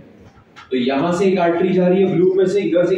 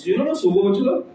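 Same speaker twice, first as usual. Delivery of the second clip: usual speaking voice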